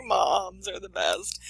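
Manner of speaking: slow, choked-up tribute; teary. Real, not acted. Host crying at the mom's sacrifice, slow and teary. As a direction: say it through tears of admiration. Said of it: "I'm, like, bawling, crying".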